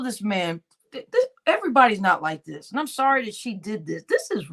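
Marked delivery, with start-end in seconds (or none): none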